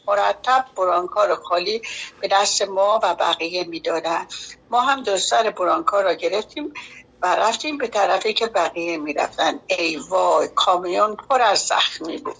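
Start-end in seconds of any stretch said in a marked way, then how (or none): none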